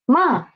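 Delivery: ma falling sharply; falling tone demo; fourth tone ma. Shown as a demonstration. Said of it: "then the four tone is".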